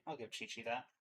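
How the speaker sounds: ordinary talk, nothing marked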